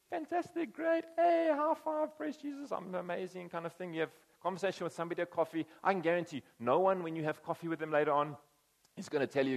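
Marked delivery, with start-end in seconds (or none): 0.1-3.5 high, hammed-up bright chirp of fake happiness; mock over-the-top cheer, way up high; a churchgoer faking that all is fine, in hammed-up cheer; fake cheerful churchgoer. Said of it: "an exercise in "How are you going?".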